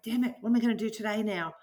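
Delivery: fast, irritated mock grumble; mock frustration. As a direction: grumble it like a stressed boss. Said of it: "It's like".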